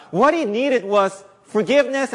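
ordinary talk, nothing marked

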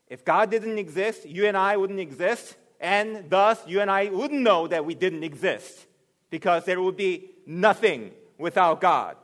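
loud, rising sermon voice; booming pulpit delivery; preaching at full force. Acted. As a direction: preach it out to the back row.

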